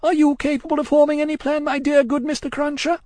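high, loud, distraught pleading; wildly crying. A narrator's reading. Miss Pross begging Cruncher for a plan, wildly distraught. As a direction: plead at the top of your pitch. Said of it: "wildly crying".

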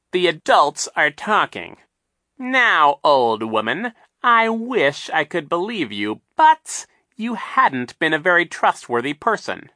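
high, loud, mocking villain voice; sly villain Captain Sham. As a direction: sneer it out like a scheming captain.

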